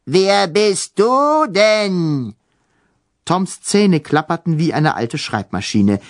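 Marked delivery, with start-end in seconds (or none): 0.0-2.3 menacing ghost baroness. Acted. Ghost baroness questions Tom.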